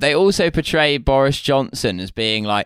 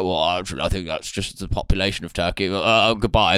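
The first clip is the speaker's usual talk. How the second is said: lower, softer bumbling politician; Boris impression; 7 semitones lower, 4 dB quieter than usual. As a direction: mumble it like an awkward toff. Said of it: "he's like".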